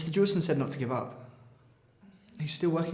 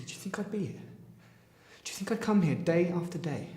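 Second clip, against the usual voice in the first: pleading; 6 semitones higher than usual. Acted. Son pleads with his mother.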